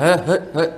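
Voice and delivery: short wordless "huh" grunts like shooing a cat; imitated gruff grunts. Son mimics his stern father during a leg massage, gruff grunts. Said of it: "Bapak pakai hurt, hurt je, tak ada bunyi apa".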